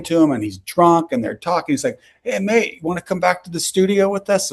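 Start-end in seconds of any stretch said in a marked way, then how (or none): 2.2-4.5 higher imitated English accent. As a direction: invite someone along in a mimicked English accent. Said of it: "kind of an English accent".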